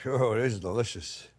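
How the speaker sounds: usual speaking voice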